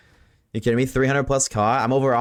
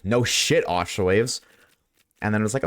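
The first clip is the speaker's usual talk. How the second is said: normal talking voice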